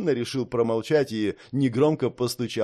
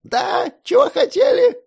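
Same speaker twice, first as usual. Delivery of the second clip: put-on high loud old man yell; deaf old man shouting; 8 dB louder than usual.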